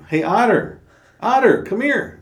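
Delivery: high, loud call down the beach. Retold or imitated. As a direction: shout across the sand to fetch him. Animals calling Otter down beach.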